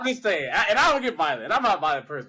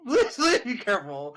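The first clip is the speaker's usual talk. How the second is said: raised, laughing plea of alarm; 2 semitones higher than usual. Real, not acted. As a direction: beg her through a laugh. Pleading after alarming story.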